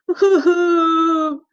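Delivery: high wailing boo-hoo; crying. A narrator's reading. Little brother crying over his broken toy, wailing. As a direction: cry like an upset little pig. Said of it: "cries George".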